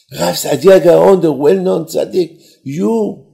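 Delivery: high, loud cry of disbelief; astonished recognition. Acted. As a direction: blurt the name out, stunned and pitched high. Host learns who guest is.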